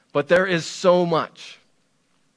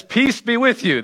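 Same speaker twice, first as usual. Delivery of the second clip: a raised, ringing peace be with you; higher greeting; 7 semitones higher than usual. Retold or imitated. Jesus greets his frightened disciples, higher. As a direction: lift the greeting up high. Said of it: "And Jesus says".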